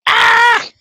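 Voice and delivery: loud, high scream of excitement; excited scream. Real, not acted. Streamer screaming to Matty over the tag, very excited. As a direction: let out a high, thrilled yell. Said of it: "He's very excited".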